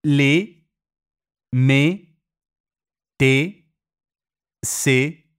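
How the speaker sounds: "Le", "me", "te" and "se" said with a closed e; closed e in short words. Shown as a demonstration. Teacher listing short French words, closed e. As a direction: keep each short word tight and closed. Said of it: "Si pronuncia chiusa".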